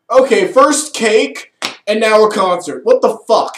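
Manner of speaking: exasperated villain. Retold or imitated. Reviewer mocks the Rage villain's complaint, loud exasperation. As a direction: play the fed-up Rage villain losing it. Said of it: "the Lieutenant of Rage is just like".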